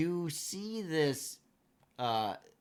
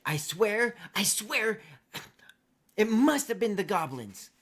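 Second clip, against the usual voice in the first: insistent halfling; 6 semitones higher, 10 dB louder than usual. Acted. Floury halfling blames goblins.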